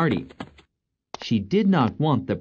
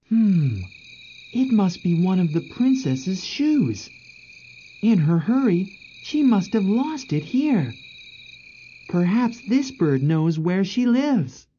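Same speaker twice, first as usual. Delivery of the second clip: higher-pitched prince working it out; pondering prince; 9 semitones higher than usual. Acted. Prince finding the princess's shoe, pondering aloud. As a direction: think aloud as the curious prince.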